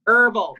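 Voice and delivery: Herbal said the American way, with a silent H: erbal; British friend asks for it again, so herbal with no H. Shown as a demonstration. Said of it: "Say it again".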